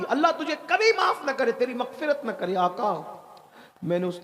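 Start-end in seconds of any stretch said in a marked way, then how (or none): 0.0-2.5 high-pitched, forceful cursing; bitter curse. A narrator's reading. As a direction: hurl the curse in a raised pitch. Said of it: "उसने बद्दुआ दी". (ऊँचे सुर में बद्दुआ देते हुए)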